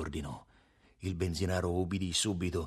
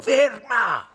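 high, loud bark; enraged, raised-pitch command; furious shout; 13 semitones higher, 10 dB louder than usual. A narrator's reading. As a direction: yell the order in fury. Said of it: "rifece furibondo".

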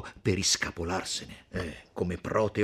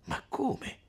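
hushed astonishment; 4 dB quieter than usual. Acted.

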